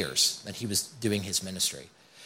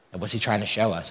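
ordinary talk, nothing marked

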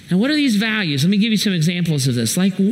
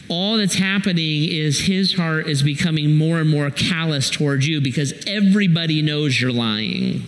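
ordinary delivery

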